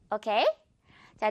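normal talking voice

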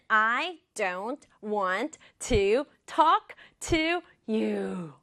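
very slow, evenly separated words. Shown as a demonstration. Teacher showing the long sentence, word by word. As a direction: give each of "I don't want to talk to you" its own slow beat.

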